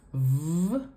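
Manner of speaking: "V" as a long buzzing consonant, not the letter name; voiced v sound. Shown as a demonstration. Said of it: "and it's voiced".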